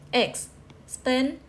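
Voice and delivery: "Expensive" said slowly, each of its three syllables stretched apart; drawn-out syllable demo. Shown as a demonstration. Teacher to pupils, counting syllables, slow drawn-out word. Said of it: "can you see we have three syllable?".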